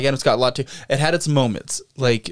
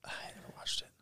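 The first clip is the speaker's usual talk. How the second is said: whisper; 25 dB quieter, much faster than usual. Real, not acted. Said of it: "whispered reply".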